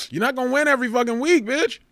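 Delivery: fast, exasperated callout of a sore loser; exasperated rant. Real, not acted. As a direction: rattle it off at the sore loser, fed up. Snapping at Lexi's sore loss.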